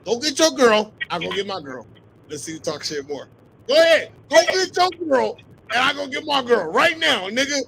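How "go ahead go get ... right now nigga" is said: loud, insistent dare; pushy, worked-up challenge; heated challenge. Real, not acted. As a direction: raise your voice and goad them on. Loud challenge in trash talk.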